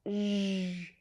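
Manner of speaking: a sustained, buzzing zh; isolated zh for learners; zh sound. Shown as a demonstration. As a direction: hold the zh as a sound on its own. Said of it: "It's that zh sound".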